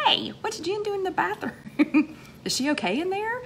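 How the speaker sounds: playful imitation of a puzzled boss; mock boss. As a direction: play the confused boss checking the bathroom. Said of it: "your boss is ... going".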